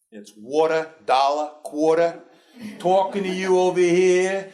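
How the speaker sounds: New York accent laid on, slow, every R dropped. Shown as a demonstration. Showing the Nebraskans his New York accent, hammed up. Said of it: "I had an accent from New York".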